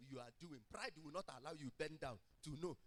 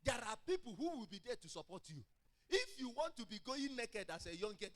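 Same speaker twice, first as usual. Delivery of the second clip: raised, driving sermon delivery, building in force; full-force preaching; 7 semitones higher, 8 dB louder than usual. Real, not acted. Preacher warns the young about their supporters, in forceful raised preaching. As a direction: press each point home, high and loud.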